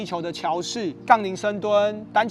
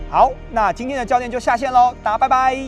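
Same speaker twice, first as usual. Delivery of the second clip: higher, louder upbeat goodbye; cheerful sign-off; 5 semitones higher, 7 dB louder than usual. Real, not acted. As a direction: wave goodbye to viewers with energy. Coach's cheerful video sign-off.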